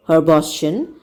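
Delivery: ordinary delivery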